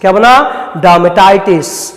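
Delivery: ordinary talk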